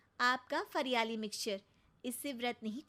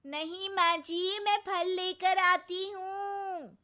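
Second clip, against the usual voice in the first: louder and higher, slow, obediently shouting back; loud reply; 7 semitones higher, 7 dB louder, much slower than usual. Acted. Daughter-in-law shouting her reply as ordered. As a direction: shout the answer dutifully. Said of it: "अरे ये नहीं".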